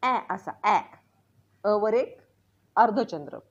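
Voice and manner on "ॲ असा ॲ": ॲ as the open "a" of cat; second sound of A. Shown as a demonstration. Teacher showing students another pronunciation of A, open vowel. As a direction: open the vowel wide on ॲ, "aeh". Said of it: "याचा दुसरा उच्चार आहे".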